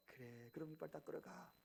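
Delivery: soft, low-key agreement; calm, encouraging mother. Acted. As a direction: send the child off gently, without scolding. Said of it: "똑같은 말이요".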